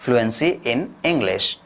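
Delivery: slow, drawn-out answer to his own question. Shown as a demonstration. Teacher slowly answering his own quiz question. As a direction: spell it out slowly for the class. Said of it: "तो क्या लेनी होगी?".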